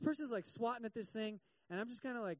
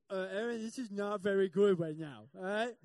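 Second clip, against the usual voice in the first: louder, thick-mouthed talking through numb lips; swollen-lipped panic; 8 dB louder than usual. Retold or imitated. A husband with numb swollen lips telling his wife something is wrong. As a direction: struggle to speak with swollen lips. Lips swelling, can't talk.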